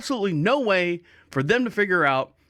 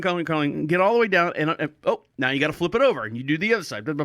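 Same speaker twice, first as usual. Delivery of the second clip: ordinary talk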